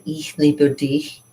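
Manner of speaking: ich liebe dich, said slowly in German; slow careful German; German phrase. Shown as a demonstration. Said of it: "I know how to say".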